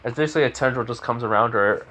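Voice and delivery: ordinary delivery, nothing marked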